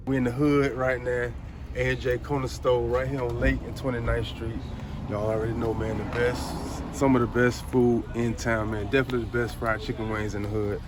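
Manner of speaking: loud upbeat store intro; hype vlog host. Acted. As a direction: sell the spot to viewers.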